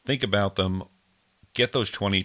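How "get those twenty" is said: normal talking voice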